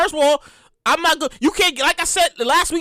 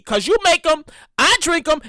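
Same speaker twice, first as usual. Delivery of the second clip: ordinary delivery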